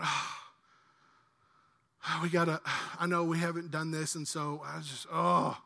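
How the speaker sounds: low, soft acted reluctance with heavy sighs; sorrowful, reluctant giving. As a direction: groan through a grudging excuse, softly. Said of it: "You should not give sorrowfully".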